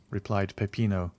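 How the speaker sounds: ordinary delivery, nothing marked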